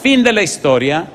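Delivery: high, loud preacher's call; emphatic announcement. Real, not acted. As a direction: ring it out to the room. Preacher announces story's climax.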